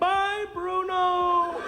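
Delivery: mock goodbye. Prince's voice saying goodbye.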